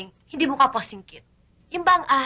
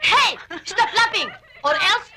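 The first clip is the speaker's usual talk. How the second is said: mock scolding yell; 8 semitones higher, slower than usual. Scolding laughing friend.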